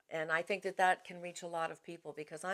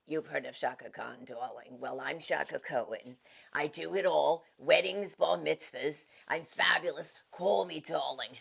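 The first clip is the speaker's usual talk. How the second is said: Shaka Cohen; 2 semitones lower, slower than usual. Shown as a demonstration. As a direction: purr every darling like a fabulous event planner. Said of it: "is event planner Shaka Cohen".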